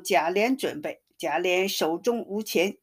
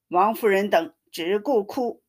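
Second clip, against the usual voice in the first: plain reading voice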